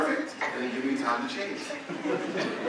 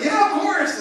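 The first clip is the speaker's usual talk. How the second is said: high, loud, keen agreement; eager assurance; 8 dB louder, slower than usual. Retold or imitated. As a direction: imitate over-eager recruiters saying yes. Brothers eagerly coax the convert.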